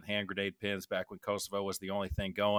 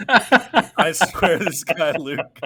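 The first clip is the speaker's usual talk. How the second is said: high, loud, slow through laughter; laughing aside; 9 semitones higher, 16 dB louder, much slower than usual. Real, not acted. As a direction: swear it while still laughing.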